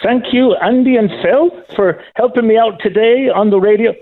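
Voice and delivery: high-pitched put-on Celtic accent, slipping into Scottish; Irish accent slipping Scottish. Caller thanking the radio hosts, high Irish accent gone Scottish. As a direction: attempt Irish, land a bit Scottish. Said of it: "I just turned a bit Scottish".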